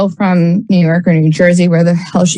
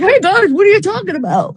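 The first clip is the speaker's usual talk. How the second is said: high goofy singsong greeting; much higher, cheery and oblivious; goofy friend; 10 semitones higher than usual. Retold or imitated. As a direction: play the clueless goofball bounding over. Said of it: "he's got this big goofy grin".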